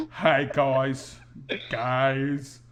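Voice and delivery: lightly voiced Australian actor faking an American accent; a hint of Chris Hemsworth straining for American vowels. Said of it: "trying to do a Chris Hemsworth doing an American accent".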